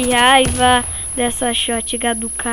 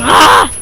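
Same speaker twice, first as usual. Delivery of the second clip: frustrated cry; 10 dB louder than usual. Real, not acted. Loud cry during match.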